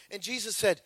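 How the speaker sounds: ordinary delivery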